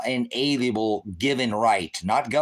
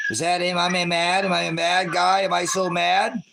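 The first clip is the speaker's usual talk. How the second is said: higher, louder sarcastic questioning; sarcastic put-on anger, higher; mock anger; 8 semitones higher, 4 dB louder than usual. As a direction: taunt them with pretend rage. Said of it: "Look at how mad and angry I am".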